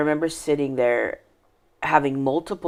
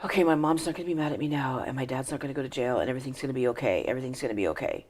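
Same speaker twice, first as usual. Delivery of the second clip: soft, fast self-reassurance; inner thought; 7 dB quieter, much faster than usual. Retold or imitated. Child leaving police station reassuring herself, hushed thought. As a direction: murmur it quickly, as if convincing yourself. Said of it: "leaving the police station thinking".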